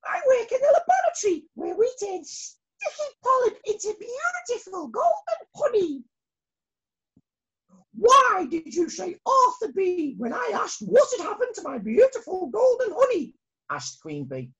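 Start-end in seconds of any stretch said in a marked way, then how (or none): 0.0-6.0 very high eager little voice; young bee Molly. Acted. Molly explains to queen. 7.9-13.4 high, louder royal question; queen questioning. Acted.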